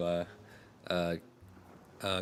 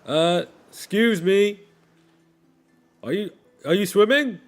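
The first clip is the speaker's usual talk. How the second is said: puzzled sailor; 11 semitones higher, 12 dB louder than usual. Acted. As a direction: call down politely but baffled. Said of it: "with binoculars just looks at you and goes".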